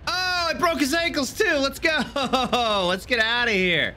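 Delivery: high-pitched, laughing celebration; gleeful triumph. Real, not acted.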